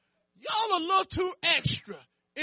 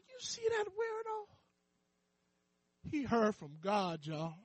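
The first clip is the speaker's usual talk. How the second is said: sarcastic whisper; 4 semitones lower, 9 dB quieter than usual. Retold or imitated. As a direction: point him out behind his back. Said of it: "Sarcasm, cracking jokes".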